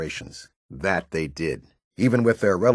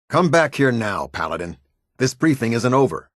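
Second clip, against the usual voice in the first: loud, raised officer's command; sharp order; 3 semitones higher, 4 dB louder than usual. Acted. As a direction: order a departing soldier straight back. Officer orders subordinate back to briefing.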